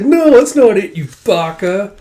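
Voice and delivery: high, loud scolding outburst; flustered protest. Acted. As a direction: protest loudly as if smacking him. Smacks him while protesting.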